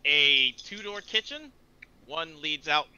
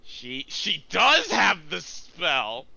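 higher, louder, slow-paced surprise; surprised; 4 semitones higher, 7 dB louder, slower than usual. Real, not acted. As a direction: exclaim it in real surprise, drawing it out. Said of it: "You sound surprised".

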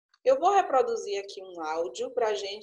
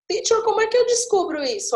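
higher, quick student's question; student asking; 9 semitones higher, 4 dB louder, faster than usual. Acted. Student asking the teacher how to find it, eager query. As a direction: pipe up fast and high like a pupil.

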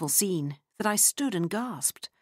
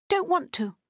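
put-on high, whiny child's refusal; sulky child; 12 semitones higher than usual. A narrator's reading. Freddie refusing Lottie, high sulky child voice. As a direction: pout it like a stubborn little girl.

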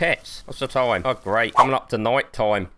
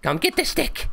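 much higher playful call to a pet; dog-calling voice. Acted. Luring the shark with a stick, high pet call.

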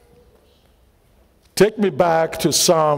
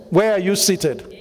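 normal talking voice

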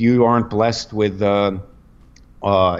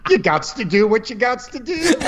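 laughing; 10 semitones higher than usual. Real, not acted. Laughing quip about negging.

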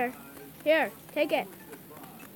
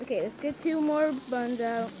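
ordinary delivery

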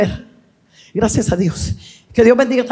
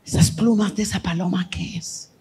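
low, soft, suspicious questioning; hushed question; 3 semitones lower, 8 dB quieter than usual. Acted. Jesus asks Andrés about stray dove feathers, hushed low question. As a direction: drop to a near-murmur to question him.

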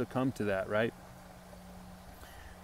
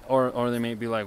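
normal talking voice

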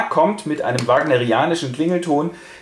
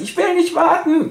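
much higher, a childish mock whine; whiny protest; 3 dB louder than usual. Jack whines at the aunt about waiting, high and petulant. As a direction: whine it like a sulking boy. Jack refuses to wait years.

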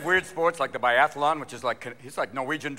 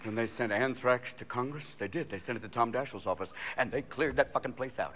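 ordinary talk

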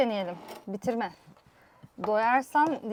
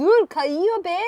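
slow, loud, high exasperated complaint; frustrated; 6 semitones higher, 6 dB louder than usual. Real, not acted. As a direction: scold the slippery food. Food slipping from chopsticks.

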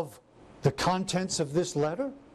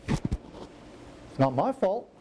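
slow whiny excuse; much slower than usual. Retold or imitated. Drawn-out excuse.

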